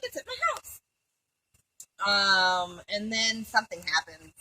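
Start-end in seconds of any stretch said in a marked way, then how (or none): 0.0-0.8 squeaky woman. Retold or imitated. Voices woman character.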